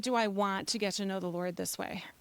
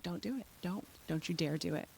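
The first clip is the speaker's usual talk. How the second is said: very soft acted warning, pitched down at the end; hushed warning; 3 semitones lower, 11 dB quieter than usual. Mother silently willing son not to buy, hushed warning. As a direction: mimic a warning under your breath.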